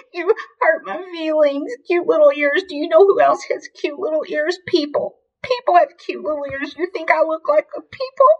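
hammed-up tearful, high-pitched complaint; crying. A narrator's reading. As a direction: sob first, then a high whiny protest. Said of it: "she would cry".

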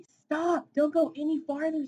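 high, loud cry to stop; frantic squirrel shout; urgent warning. Acted. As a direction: yell a warning to halt a friend. Squirrel warns Birdie of danger.

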